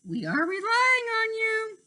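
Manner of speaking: lifted, earnest fairy queen; high fairy voice. A narrator's reading. The queen entrusting Tink with the task, high earnest voice. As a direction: ask it high and sincerely.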